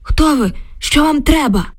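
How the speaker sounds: startled cry. Acted. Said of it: "від несподіванки скрикнув".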